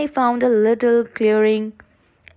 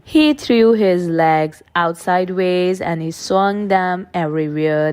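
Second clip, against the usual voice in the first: usual speaking voice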